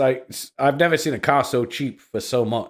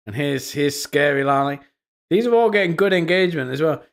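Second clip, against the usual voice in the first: normal talking voice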